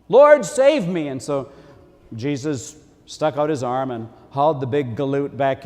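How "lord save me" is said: loud, high, slow cry for rescue; a sinking man begging to be saved; terrified plea. Retold or imitated. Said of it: "He was terrified".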